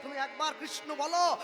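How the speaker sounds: pleading shout. Retold or imitated. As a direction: call it out, begging. God falling at their feet.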